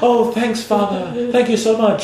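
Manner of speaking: high-pitched mock British baby bird; fake British child. Baby birds thanking father, in fake British accent. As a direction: put on a grateful little British voice. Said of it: "Why are they British?".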